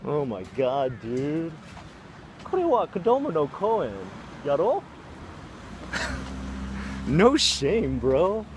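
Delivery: high-pitched outraged telling-off; disgusted scolding. Real, not acted. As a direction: shame him across the park. Scolding the man in Japanese.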